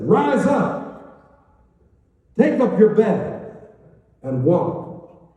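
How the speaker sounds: loud, high, slow command; ringing preacher's command; commanding healer. Acted. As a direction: call the sick man to his feet. Jesus commands the paralysed man.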